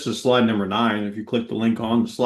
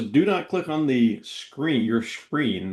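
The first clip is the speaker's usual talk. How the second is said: measured, each word separate; slower restatement; slower than usual. Real, not acted. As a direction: spell it out slowly for someone who missed it. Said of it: "You said that fast. I wanna hear".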